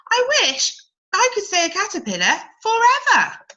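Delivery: longing to stay as she is; wistful caterpillar. Acted. Cody wishes to stay.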